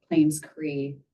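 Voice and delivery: normal talking voice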